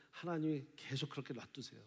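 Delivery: ordinary talk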